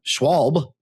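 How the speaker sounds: "Schwalbe" said as SHWALB, final E silent; one-syllable pronunciation. Shown as a demonstration. Co-host teaching how to pronounce the tire name, "Schwalbe" as SHWALB. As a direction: drop the last vowel of "Schwalbe", ending on the B. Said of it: "another tip on how to pronounce the name".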